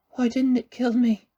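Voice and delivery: groaning. A narrator's reading. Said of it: "he groaned".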